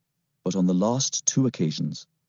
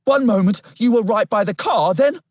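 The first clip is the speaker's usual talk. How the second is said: high, louder, winded policeman; panting disbelief; 12 semitones higher, 5 dB louder than usual. A narrator's reading. Policeman baffled by how the boy moved, panting disbelief. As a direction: blurt it out in breathless bafflement. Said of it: "he panted".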